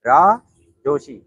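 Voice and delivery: रा jumped up and loud, then जोशी; syllable by syllable. Shown as a demonstration. Teacher spells out the name रा जोशी slowly to learn it. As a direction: break it into रा, pause, जोशी. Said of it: "जोशी थोड़ा easy हो".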